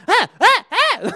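short, very high startled yelps; three quick high-pitched shrieks in imitation; three imitated yelps. Friends found by a cop downstairs, imitated yelps. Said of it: "I hear three yelps simultaneously downstairs".

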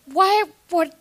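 high, bright protesting squeak; overly chipper, covering up; fake cheerful denial.

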